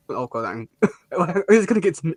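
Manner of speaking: usual speaking voice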